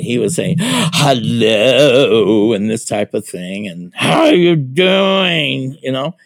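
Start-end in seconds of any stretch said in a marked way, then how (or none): 0.6-2.5 drawn-out hello in a put-on voice; mocking sing-song hello. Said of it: "it was the way he was saying it". 3.9-5.8 slow, high, loud drawn-out "How are you doing?"; exaggerated slow greeting. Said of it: "it was the way he was saying it".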